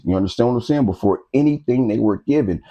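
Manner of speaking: normal talking voice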